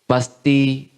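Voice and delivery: ordinary talk, nothing marked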